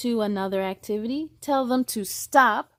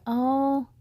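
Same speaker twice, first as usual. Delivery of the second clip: ordinary delivery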